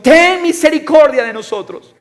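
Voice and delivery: very high, loud cry for mercy; loud, soaring appeal; exclaimed plea. Acted. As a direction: shout the plea at full voice. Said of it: "está en exclamación".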